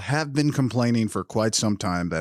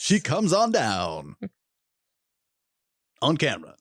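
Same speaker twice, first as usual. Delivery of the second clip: game show announcer. Acted. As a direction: call her down like a prize show host. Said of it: "game show announcer".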